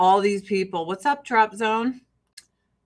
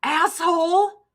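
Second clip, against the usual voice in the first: high, slow angry yell; mock road rage; 12 semitones higher, 6 dB louder, slower than usual. Herself yelling at a bad driver, slow outraged yell. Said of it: "outside of me being like".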